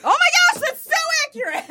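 real high-pitched laughing exclamation; cracking up. Real, not acted. Reacting to the friend's church joke, cracking up. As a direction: squeal it through laughter.

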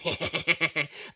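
wicked little goblin snicker; mischievous creature cackle; goblin giggles. Acted. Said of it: "you hear the giggles of the goblin".

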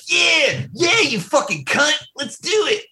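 mock an over-eager Aussie, high and drawn out; exaggerated high-pitched Australian yell; Bede. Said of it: "then he would just be like".

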